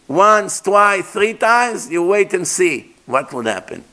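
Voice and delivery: ordinary delivery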